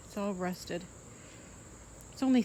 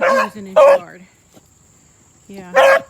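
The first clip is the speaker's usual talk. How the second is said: loud and high; 18 dB louder, slower than usual. Real, not acted. Sudden loud remark.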